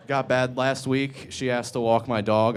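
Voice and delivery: normal talking voice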